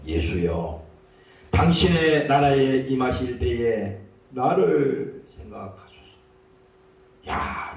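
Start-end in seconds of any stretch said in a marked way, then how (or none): 0.1-6.2 low, solemn prayer swelling slowly then sinking to a hush; heartfelt plea. Acted. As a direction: plead humbly in a deep register. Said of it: "자기의 진심으로 예수님 앞에 부탁하는 겁니다".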